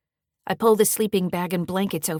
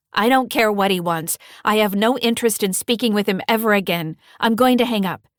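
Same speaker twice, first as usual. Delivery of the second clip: firm, louder refusal; 4 semitones higher, 8 dB louder than usual. Acted. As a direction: shut him down, loud and firm. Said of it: "say in a firm voice".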